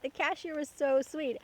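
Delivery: ordinary talk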